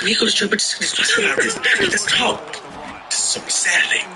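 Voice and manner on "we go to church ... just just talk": higher, pleading wife voice; imitating wife. Wife begging her husband to just talk. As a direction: play the worried wife, voice pitched up. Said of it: "She's like".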